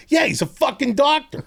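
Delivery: high, exasperated outburst; incredulous and exasperated. Retold or imitated. Mocking the doctor's dismissal.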